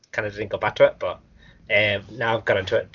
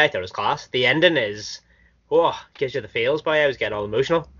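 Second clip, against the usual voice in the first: ordinary delivery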